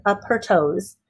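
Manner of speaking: plain reading voice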